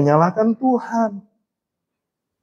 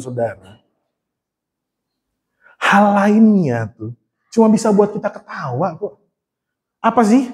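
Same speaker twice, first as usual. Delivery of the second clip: ordinary talk, nothing marked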